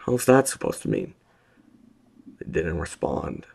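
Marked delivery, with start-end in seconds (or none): 0.0-1.2 raised call. A narrator's reading. Prisoner calls down, alarmed.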